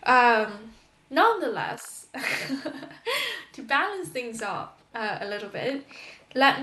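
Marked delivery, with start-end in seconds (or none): 2.1-3.5 high, light laugh; real laugh. Real, not acted. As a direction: let out an amused, high-pitched laugh. Amused after her complaining.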